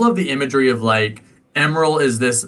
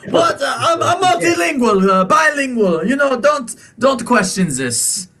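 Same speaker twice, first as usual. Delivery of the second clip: high, huffy shopkeeper in a put-on accent; defensive shopkeeper; 11 semitones higher than usual. Shopkeeper brushing off the accent question, in a huffy high voice. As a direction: brush off the question in his fussy voice.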